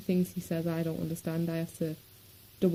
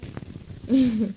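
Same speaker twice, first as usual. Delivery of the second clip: short burst of laughter, higher and louder; 5 semitones higher, 7 dB louder than usual. Real, not acted. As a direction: let out a real, brief laugh. Laughs after pointing out cat.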